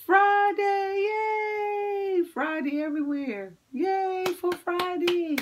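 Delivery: high gasping shout of joy; broad singsong kids theatre. Acted. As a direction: squeal with delight for the kids.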